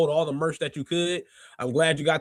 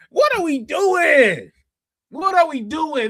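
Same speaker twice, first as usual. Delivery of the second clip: raised, drawn-out plea of disbelief; exasperated outcry; 10 semitones higher, 6 dB louder, slower than usual. Acted. Host appalled at what's on screen, exasperated outcry. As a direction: cry it out high and slow, appalled. Acted outburst at what he sees.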